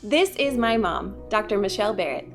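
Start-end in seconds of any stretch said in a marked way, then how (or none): none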